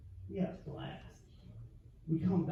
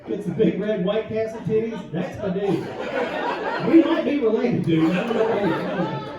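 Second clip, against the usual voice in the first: much louder, pitched up; hyped crowd shout; 4 semitones higher, 9 dB louder than usual. Real, not acted. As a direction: call out to the guy in the crowd. Crowd work, shouting at audience.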